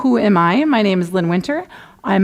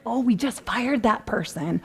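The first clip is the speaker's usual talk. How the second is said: sheepish aside; 2 semitones higher, 7 dB quieter than usual. Retold or imitated. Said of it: "Or like".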